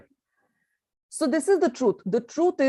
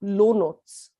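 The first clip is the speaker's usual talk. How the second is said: low notes; 2 semitones lower than usual. Shown as a demonstration. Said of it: "how hoarse is it?".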